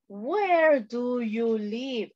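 very slow, drawn-out question; slow modelling. Shown as a demonstration. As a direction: stretch each word for beginners. Teacher models question for learners. (pregunta muy lenta, alargando cada palabra)

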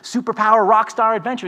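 hammed-up upbeat HR announcement; mock cheery email. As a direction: sell a firing as a celebration. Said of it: "these cheery emails saying".

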